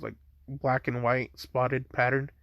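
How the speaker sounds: ordinary delivery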